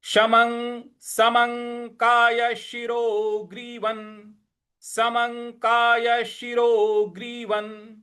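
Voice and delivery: slow model reading for students; model recitation. Shown as a demonstration. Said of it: "तो ये हो जाएगा आपका". (धीमा आदर्श उच्चारण)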